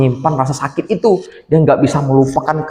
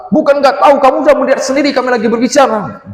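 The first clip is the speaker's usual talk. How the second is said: higher and louder, snapping at him; angry sister; 9 semitones higher, 3 dB louder, faster than usual. Acted. Older sister snapping at her little brother for interrupting, sharp and loud. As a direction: cut his excuse off sharply. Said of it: "kata si Helen".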